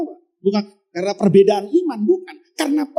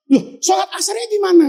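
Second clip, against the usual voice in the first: mock shouted argument; 8 semitones higher, faster than usual. Soldiers quarrel over prayer time.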